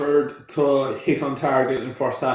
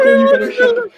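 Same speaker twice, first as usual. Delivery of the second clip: much higher and louder mock scolding; mock angry wife; 7 dB louder, faster than usual. Mocking the wife scolding JJ, high shrill telling-off. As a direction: ham up a furious wife. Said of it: "she's still giving out".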